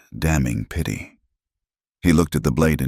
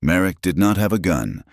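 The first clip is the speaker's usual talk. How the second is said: plain reading voice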